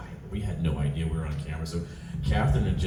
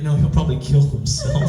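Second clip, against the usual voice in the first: higher, louder, breaking into laughter; laughing objection; 8 semitones higher, 7 dB louder than usual. Retold or imitated. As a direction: say it cracking up at the idea. Quotes objection to prank while laughing.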